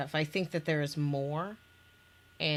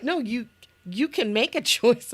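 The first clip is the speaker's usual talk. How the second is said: higher, louder, laughing as you speak; laughing reassurance; 6 semitones higher, 7 dB louder than usual. Real, not acted. Host urging a player to choose, laughing. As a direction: insist through real laughter.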